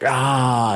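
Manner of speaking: high, loud mock yell; imitated yell of release; retold yell. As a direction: let out a yell to blow off steam. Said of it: "he's, like, yelling".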